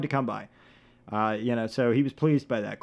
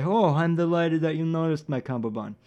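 put-on godfather voice, badly faked; Marlon Brando impression; 2 semitones higher than usual. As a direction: play a pleased mob boss, hammed-up. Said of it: "what Marlon Brando actually said is".

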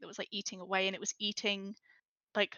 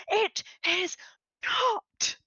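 high, louder retold yell; mock scream; 11 semitones higher, 5 dB louder, much slower than usual. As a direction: fling it back as a hammed-up scream. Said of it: "I'm there just screaming in their face".

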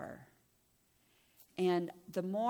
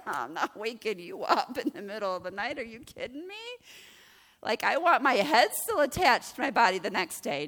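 mock-outraged kid, getting louder; hammed-up refusal, building to a loud protest; exaggerated incredulous retelling; 4 semitones higher, 8 dB louder than usual. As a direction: imitate a son protesting in disbelief. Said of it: "he didn't quite say it that way".

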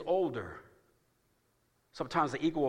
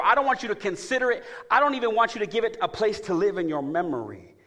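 high, loud, slowed preaching cadence; full-force preaching; 7 semitones higher, 9 dB louder than usual. Acted. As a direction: preach it out to the church.

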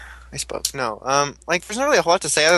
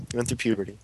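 deeper voice; 6 semitones lower, 7 dB quieter, faster than usual. Pretend. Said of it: "Philip has a deeper voice now".

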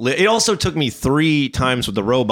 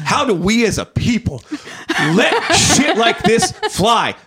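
high, hammed-up righteous indignation through laughter; drawn-out, high-pitched soapbox plea; mock outrage; 8 semitones higher than usual. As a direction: declaim it slowly like a protest speech. Reads own outraged text, laughing.

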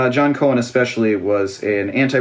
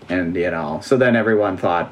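ordinary delivery

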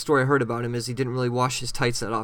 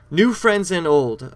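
title announcement; 4 semitones higher, 6 dB louder, much slower than usual. Acted.

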